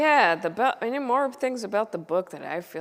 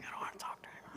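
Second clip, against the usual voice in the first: unvoiced whisper, sulky; whispered reluctance; 19 dB quieter, much faster than usual. Telling her sister she won't talk to anyone, whispered. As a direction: breathe it out like a reluctant kid.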